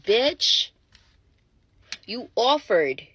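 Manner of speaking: loud, high, exasperated retort; indignant outburst. Retold or imitated. Woman fires back about the Uber offer, loud indignation. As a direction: hit him back loudly with comic outrage.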